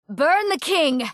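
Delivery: high, drawn-out festival cheer; rallying cry. Acted. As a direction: call it out to rouse the crowd. Leading crowd at festival burning.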